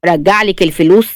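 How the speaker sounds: ordinary delivery, nothing marked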